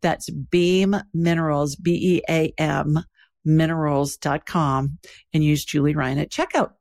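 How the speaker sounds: calm, even sponsor read. Acted. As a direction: read the ad steadily and clearly. Host reads sponsor ad.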